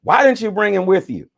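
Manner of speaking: high, loud angry demand. A narrator's reading. High priests demanding of the temple police, loud and angry. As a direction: bark the question at them. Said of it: "who demanded".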